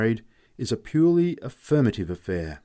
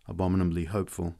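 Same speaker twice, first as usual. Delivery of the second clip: plain reading voice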